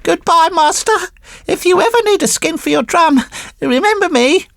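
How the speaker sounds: high puppet voice calling back while swimming; Pinocchio calling out. Acted. Pinocchio calling goodbye to his master from the sea, high teasing voice. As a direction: call a cheeky farewell across the water. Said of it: "he cried out, laughing".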